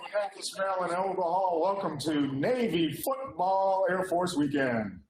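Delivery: put-on radio host welcome. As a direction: open the show like a live radio report. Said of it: "Trying to get my radio voice on".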